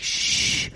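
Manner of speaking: voiceless hush, acted out; imitated shushing. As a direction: shush the room for a singer. Listeners round a fire hush others.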